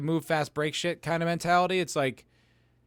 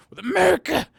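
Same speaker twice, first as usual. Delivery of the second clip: high, loud cry of the word America; sudden loud patriotic exclamation; emphatic shout; 14 semitones higher, 7 dB louder than usual. Real, not acted. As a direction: throw it out like a rallying call.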